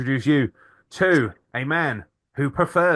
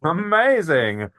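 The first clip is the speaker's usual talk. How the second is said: normal talking voice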